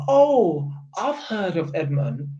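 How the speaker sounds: mock the manager pretending she barely knows Edmonton; mocking impression. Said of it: "She's one of them people, now she's got money".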